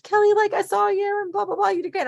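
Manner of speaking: high-pitched excited fangirl gush; gushing friend. Retold or imitated. Voices an admiring friend's gushing.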